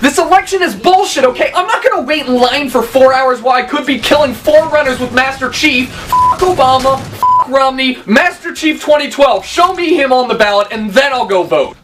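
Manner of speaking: loud, shouted rant at a friend; furious gamer kid; raging gamer. Acted. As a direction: yell like you were interrupted mid-game.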